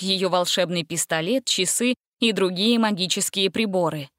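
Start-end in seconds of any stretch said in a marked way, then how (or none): none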